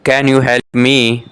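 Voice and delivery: usual speaking voice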